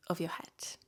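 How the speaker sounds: normal talking voice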